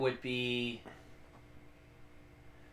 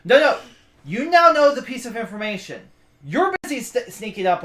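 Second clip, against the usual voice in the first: excited protest; 6 semitones higher, 7 dB louder than usual. Real, not acted. GM stops player's bell plan.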